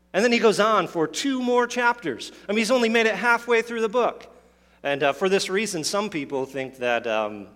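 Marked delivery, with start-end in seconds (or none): 0.1-4.3 high-pitched, mock-incredulous punchline; comic exasperation. Real, not acted. Preacher joking to congregation about Paul's "finally", comic exasperation. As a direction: raise the pitch in amused disbelief.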